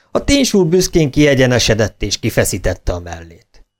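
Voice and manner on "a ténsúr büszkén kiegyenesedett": ordinary delivery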